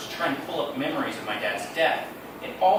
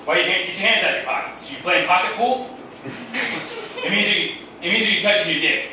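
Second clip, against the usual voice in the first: loud, raised, scolding dad voice; gruff dad; 4 semitones higher, 6 dB louder than usual. Retold or imitated. Dad teaching his son to mow, loud scolding. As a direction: lecture the kid loudly, impatiently.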